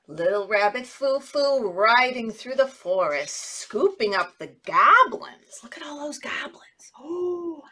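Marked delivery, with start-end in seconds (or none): 5.6-7.6 hushed, awed whisper. Acted. Teacher whispering to children about the goblins. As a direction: whisper as if the goblins might hear.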